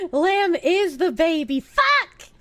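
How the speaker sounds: high, slow build to a loud yelp; exasperated outburst. Real, not acted. Streamer realising lamb is the baby, high exasperated cry. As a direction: burst out on the curse, exasperated. Exasperated at her own mistake.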